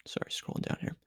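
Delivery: hushed aside under the breath; whispered aside. Real, not acted. Reader apologising to listeners while scrolling, whispered.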